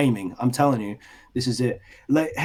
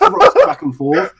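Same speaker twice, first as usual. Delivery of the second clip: excited squeal; 13 semitones higher, 6 dB louder than usual. Real, not acted. Excited description of device.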